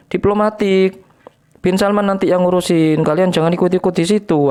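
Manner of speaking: high mocking scold at the Syrians; mock scolding Harry Pras. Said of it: "kalau tahu Harry Pras Anda dimarahin".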